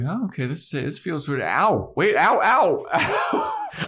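building from high puzzled to loud high yelps; mock yelp. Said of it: "someone scream as much as him".

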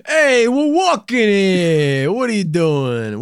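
high, slow New York "walking here" bark; New York yell. Acted. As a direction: play an angry New York cabbie. Mock New York street yell.

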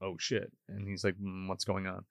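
low, hushed voice. Retold or imitated. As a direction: keep it under your breath. Parents hushed, hiding from the visitor.

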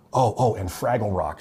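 ordinary talk, nothing marked

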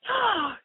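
startled gasp; sharp gasp. Real, not acted. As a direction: gasp in alarm. Horrified at calling him.

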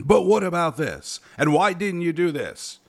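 high, mocking interrogator's voice; hammed-up gotcha interviewer; mock gotcha questions. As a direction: pepper them with needling questions, pitched right up. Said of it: "every question has to be a gotcha question".

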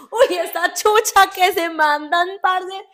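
loud, high laughing groan of disgust; mock-horrified laughing rant. Real, not acted. Laughing about body odor.

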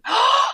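sharp excited gasp, pitched high. Retold or imitated. Actor recalling a guest role, excited gasp. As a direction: gasp like the order just arrived.

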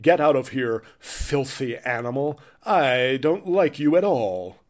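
slow, exaggerated dubbed British villain; dubbed-movie villain. Shown as a demonstration. Villain throwing someone out, hammed-up dubbed delivery. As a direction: overdo it like a badly dubbed kung fu film. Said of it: "nope, not dubbed enough".

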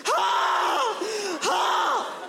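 high-pitched acted scream. Actor slams his fist against a metal door, screaming. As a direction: imitate an actor screaming full out in a scene. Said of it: "he starts screaming and slamming his fist".

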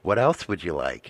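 low hushed murmur in his ear; whispered offer. Retold or imitated. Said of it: "she comes and whispers into your ear".

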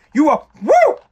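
high, loud whooping cheer. Acted. Host acting out the midnight drinking high, loud whoop. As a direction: let out a drunk party woo. Cheering about being drunk.

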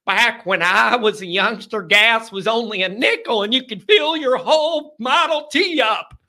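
put-on high, loud old-timer rambling to a shout. Host jokes about his age as old-timer. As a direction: ham up a crusty old man.

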